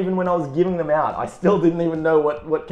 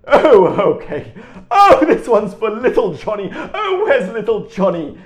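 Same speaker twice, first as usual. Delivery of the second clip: jolly Santa voice; 7 semitones higher, 9 dB louder than usual. Retold or imitated. Santa reads out gift names.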